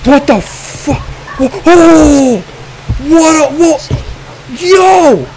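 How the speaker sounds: loud, high frightened outbursts; panicked, high-pitched exclamations; real startled screams. Real, not acted. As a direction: yell in shock at a jump scare. Startled by a scare in game.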